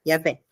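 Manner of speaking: "Iavé" said low with a V sound, stress on VÉ; demonstrated pronunciation. Shown as a demonstration. Teacher confirming the vav as V, low falling pronunciation. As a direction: say "Iavé" briefly, falling on a final VÉ. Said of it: "o vav faz o som de V".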